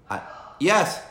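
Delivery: calling off-mic; turned away answering someone; turning away from phone. Real, not acted. Answers wife from another room.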